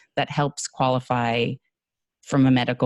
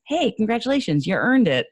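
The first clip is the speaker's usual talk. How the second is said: high, louder mock congratulations; joking, condescending; 9 semitones higher, 3 dB louder than usual. Commercial actor congratulating a new office holder, jokingly condescending. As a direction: congratulate them patronisingly, in character. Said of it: "jokingly in the character at the time".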